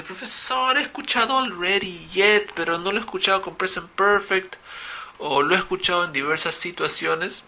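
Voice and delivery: voz aguda de alumno curioso, algo exagerada; alumno que pregunta. Retold or imitated. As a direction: imita a un estudiante que duda. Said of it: "De repente alguien dice". (un alumno le plantea su duda al profesor, voz aguda y curiosa)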